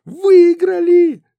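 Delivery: very high, loud, slow squeal; joyful shout. Acted. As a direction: squeal it out with joy. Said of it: "прокричала Лена".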